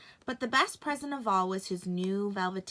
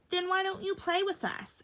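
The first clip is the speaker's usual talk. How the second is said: much higher creature voice; high teasing bunny voice; wild rabbit; 10 semitones higher than usual. A narrator's reading. Wild rabbit daring the toy rabbit, very high. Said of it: "asked the other rabbit".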